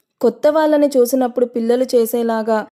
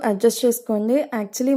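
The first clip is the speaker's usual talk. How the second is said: usual speaking voice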